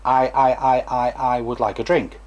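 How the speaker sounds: demonstrated disfluency with steady intonation; easy, unforced stammer on opening words; even repetitions. Shown as a demonstration. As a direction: repeat the first word evenly, without straining. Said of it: "even repetitions".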